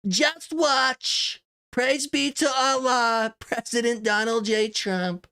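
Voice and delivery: slow, high imitation of Trump's threat post; Trump impression. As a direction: ham up the president reading his warning, slowly. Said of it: "we'll do the Trump impression".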